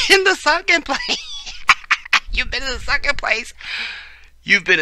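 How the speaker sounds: very high, squeaky words through laughter; cracking up. Real, not acted. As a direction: try to read it while helplessly laughing. Cracking up reading a tweet.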